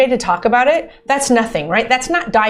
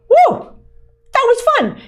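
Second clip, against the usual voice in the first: high whoop, then gleeful delight; acted exhilaration; 13 semitones higher than usual. First-timer after taking the plunge, high mock-thrilled whoop. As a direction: whoop high like someone fresh off a dive. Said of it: "And then you go".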